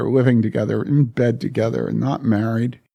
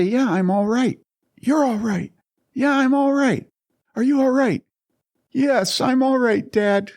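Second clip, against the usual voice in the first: pitched high and strained, near tears; words catch in the throat as the memory hits; voice breaking; 13 semitones higher than usual. Real, not acted. Son recalling his father's midnight visit, voice breaking with emotion.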